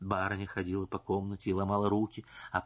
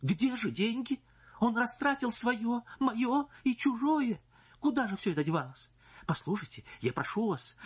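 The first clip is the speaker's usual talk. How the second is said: high, imploring voice; pleading wife; 10 semitones higher than usual. Acted. Wife begging the singer, high imploring voice.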